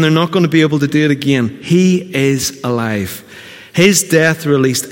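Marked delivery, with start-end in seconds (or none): 1.6-3.2 very slow, each word weighted; measured proclamation; slow emphatic declaration. Acted. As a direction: land it firmly. Preacher declares the resurrection.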